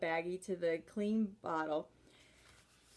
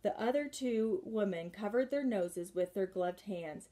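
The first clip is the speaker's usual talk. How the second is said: plain reading voice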